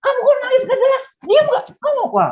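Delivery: high-pitched acted telling-off; angry parent scolding. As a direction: play an exasperated parent demanding silence. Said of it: "lalu kita marah-marahin".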